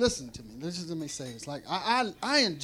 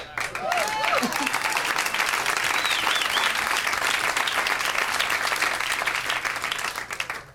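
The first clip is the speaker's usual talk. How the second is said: long laugh. Real, not acted. Laughing at his own line.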